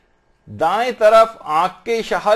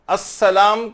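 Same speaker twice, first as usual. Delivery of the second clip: usual speaking voice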